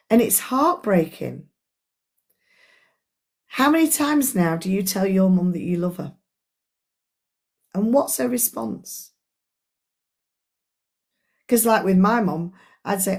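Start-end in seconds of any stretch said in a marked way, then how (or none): none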